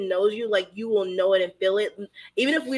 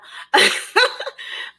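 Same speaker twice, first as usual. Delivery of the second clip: high, breathless laugh fighting tears; teary laugh; 4 dB louder than usual. Real, not acted. Close to tears about her friend, teary laugh. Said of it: "I feel like I'm gonna cry now".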